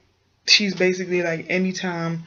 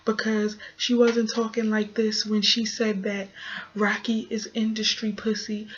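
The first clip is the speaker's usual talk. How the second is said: dramatic confessional voice; 3 semitones higher, 3 dB quieter than usual. Retold or imitated. Recapper mocking a reality star's confessional delivery, hammed-up dramatic. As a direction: put on her overly dramatic interview voice. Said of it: "is so dramatic. I don't know why she talks like this".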